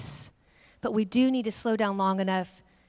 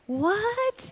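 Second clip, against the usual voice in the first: stunned; 10 semitones higher than usual. Retold or imitated. Family stunned by bird escaping.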